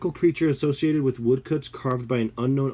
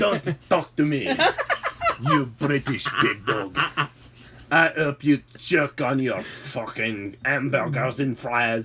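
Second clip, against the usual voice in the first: hammed-up German accent with laughs; mock German insult. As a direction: insult the British pig dog in German voice. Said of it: "accents were so cringy".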